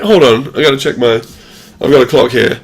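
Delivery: ordinary delivery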